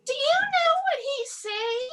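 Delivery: high, prying voice; nosy Karen. Retold or imitated. Comic playing the staring Karen at the grocery store, high and prying. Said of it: "this Karen had the nerve to ask me".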